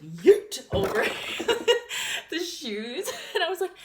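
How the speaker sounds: high, loud laughter breaking through; laughing hard. Real, not acted. Telling viewers how she threw her roommate, laughing loudly.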